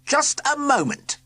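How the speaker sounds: high, slow, looming call; dread voice. A narrator's reading. Sir Quincy halting the group, a slow dreadful cry. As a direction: stop everyone cold with menace. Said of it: "cried a dread voice".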